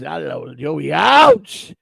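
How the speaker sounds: teasing catchphrase impression, soaring finish; Big Boss. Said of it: "Big Boss".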